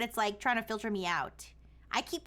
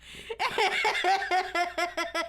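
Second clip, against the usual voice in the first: big laugh, pitched up; cracking up loudly; loud burst of laughter; 5 semitones higher, 4 dB louder than usual. Real, not acted. User laughs at Santa.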